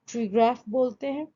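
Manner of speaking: normal talking voice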